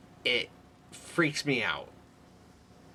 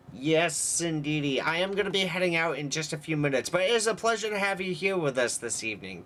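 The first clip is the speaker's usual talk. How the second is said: higher, bright welcome-to-the-show voice; cheery host greeting; 5 semitones higher than usual. Acted. Streamer welcoming a viewer, hostess voice.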